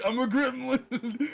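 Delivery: high, slow gremlin squeal through laughter. Pretend. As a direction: stretch it out like a cackling gremlin. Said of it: "it's the goblin in us".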